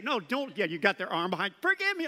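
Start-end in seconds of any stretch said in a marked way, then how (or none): 1.6-2.1 high, loud demand for forgiveness; mock bully demanding pardon; forced apology. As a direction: bark it while twisting their arm.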